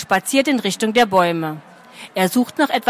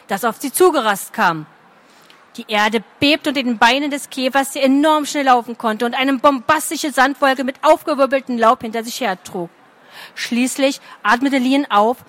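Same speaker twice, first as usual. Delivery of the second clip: usual speaking voice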